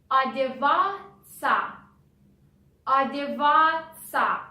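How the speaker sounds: Одеваться with the O said as A, the T silent and ться as ца; careful Одеваться. Shown as a demonstration. Teacher showing learners the spoken form. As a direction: say Одеваться as adevatsa, no T. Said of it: "Have you heard?".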